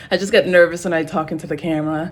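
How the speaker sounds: rushed, nervous fast talk; talking really fast. Real, not acted. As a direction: hurry through it nervously. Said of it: "I don't know why I'm talking really fast".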